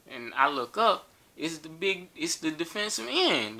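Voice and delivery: normal talking voice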